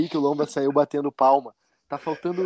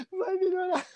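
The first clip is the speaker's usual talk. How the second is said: higher, softer, voice breaking into tears; choked-up crying; 13 semitones higher, 6 dB quieter than usual. Retold or imitated. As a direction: imitate someone crying as they speak. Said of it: "começa a chorar".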